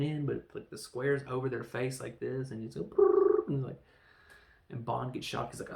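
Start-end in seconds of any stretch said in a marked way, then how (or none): 2.8-3.5 loud rolled "brrr" buzz; loud trilled buzzing noise; imitated shock buzz. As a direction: make the noise of an electric zap.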